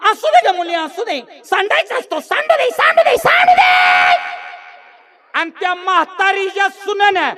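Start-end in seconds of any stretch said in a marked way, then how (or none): 0.1-4.2 put-on voice climbing very high; hammed-up coddling of her own daughter, rising to a stretched squeal; indulgent old mother. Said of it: "ती म्हातारी पोटच्या लेकीला म्हणली". (लेकीचे लाड करणाऱ्या म्हातारीचा उंच आवाज)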